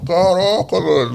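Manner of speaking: high, loud outcry of amazement; overwhelmed shout. Retold or imitated. On the street he shouts, overwhelmed by God's message, high and loud. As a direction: cry out, overwhelmed and stunned. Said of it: "começou a gritar assim, a chorar".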